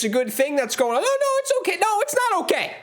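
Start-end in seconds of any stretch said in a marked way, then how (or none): 1.0-1.8 very high, strained phone voice; imitating a fan putting on a high reassuring voice; high-pitched fake reassurance. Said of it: "your high-pitched voice going on". 1.8-2.8 high, shouted exasperated protest; angry outburst. Real, not acted. Fan drops the pretence, protests.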